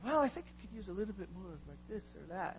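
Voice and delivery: lightly mock snobby critic; a hint of a pompous food critic; food critic mode. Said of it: "go into food critic mode".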